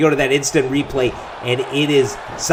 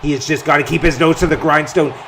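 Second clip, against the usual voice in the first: ordinary delivery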